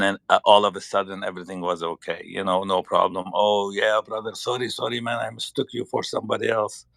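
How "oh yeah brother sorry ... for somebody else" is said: ordinary talk, nothing marked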